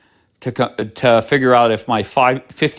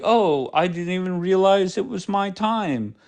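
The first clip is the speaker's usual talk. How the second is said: mocking quote; 6 semitones higher, 4 dB quieter than usual. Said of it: "you're like".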